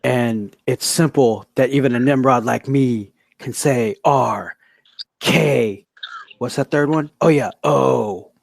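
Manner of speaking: low, halting promo delivery with pauses between phrases; choppy wrestling promo. Acted. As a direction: cut a promo in slow, measured chunks. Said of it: "choppy like that".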